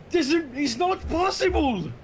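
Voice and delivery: hammed-up disbelief. Hammed-up disbelief at pink paradox.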